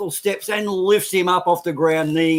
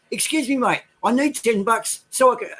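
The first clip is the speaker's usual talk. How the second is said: higher-pitched put-on English accent; Englishman's accent, pitched up for a joke; 7 semitones higher than usual. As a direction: ask politely in a mock English accent. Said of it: "in an English accent, of course".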